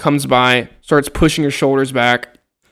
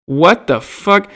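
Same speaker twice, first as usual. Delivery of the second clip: sharp, loud, much higher disbelieving yell; retold furious shout of disbelief; angry outburst; 8 semitones higher, 7 dB louder, much slower than usual. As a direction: blurt it out like you can't believe the nerve. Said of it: "And I'm like".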